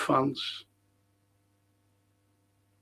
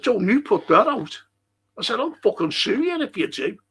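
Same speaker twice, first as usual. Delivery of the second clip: higher, pressed warning; fuming threat; 7 semitones higher than usual. Retold or imitated. Warning the fella not to air it, fuming. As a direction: threaten him through rage. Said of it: "I fucking fumed".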